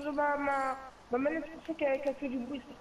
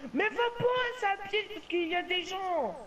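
urgent warning; 6 semitones higher than usual. Real, not acted. Warning teammate of trap.

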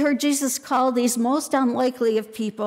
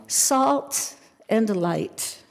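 each word set apart, slowly and deliberately; measured preacher's emphasis; slow emphatic theme; much slower than usual. Real, not acted. Sermon theme stated slowly.

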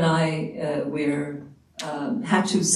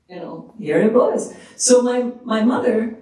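ordinary talk, nothing marked

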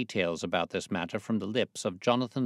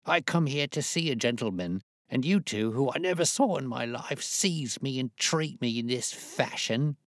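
put-on indignant old man; 6 semitones higher than usual. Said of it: "he cried".

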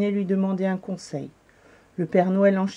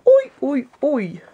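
high, loud hoots stepping down; owl-like high cry; hooting owl; 7 semitones higher, 6 dB louder, slower than usual. A narrator's reading. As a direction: hoot like an owl in dismay. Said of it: "ulula la chouette".

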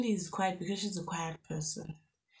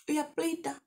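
scoffing; 9 semitones higher than usual. Retold or imitated. Mocking boyfriend as liar.

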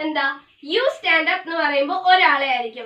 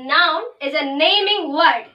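very slow and louder, every word separate; slow dictation; 5 dB louder, much slower than usual. Shown as a demonstration. Teacher repeating the definition for notes, slow dictation. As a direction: say it so the class can write it down. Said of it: "definition repeated for copying".

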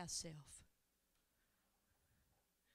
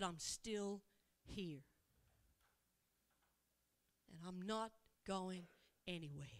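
soft reassurance; 4 semitones lower, 4 dB quieter than usual. Acted. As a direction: quietly promise to stay. Jesus repeats promise softly.

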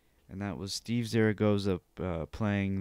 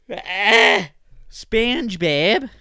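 much louder and higher, stretched SpongeBob; excited outburst; 10 semitones higher, 14 dB louder, much slower than usual. Real, not acted. Announcing SpongeBob news with a loud excited yell. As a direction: burst out with the name, thrilled.

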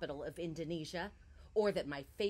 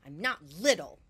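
sharp defiant outburst; 8 dB louder than usual. Acted. Muttered defiantly to nobody.

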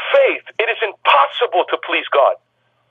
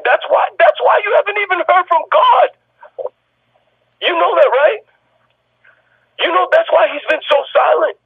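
higher, pressing sermon delivery; forceful preaching; 8 semitones higher than usual. Acted. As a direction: drive it home to the congregation. Pastor rising into forceful preaching.

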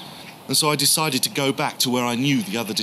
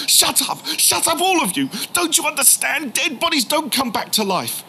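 furious shout; 12 semitones higher than usual. Retold or imitated. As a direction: shut them all up with rage. Angry outburst at disciples.

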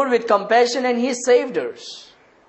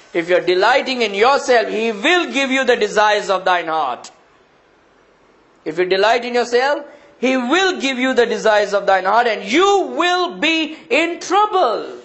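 preaching warning; 4 semitones higher than usual. Acted. Preacher warning the self-delighting congregation, forceful and building. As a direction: warn them with rising force.

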